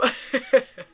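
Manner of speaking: brief, amused laugh; real chuckle. Real, not acted. Podcaster laughing after postponing a story, brief chuckle.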